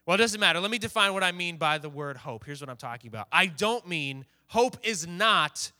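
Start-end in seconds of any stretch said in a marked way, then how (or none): none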